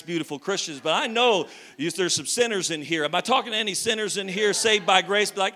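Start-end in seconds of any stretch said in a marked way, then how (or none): none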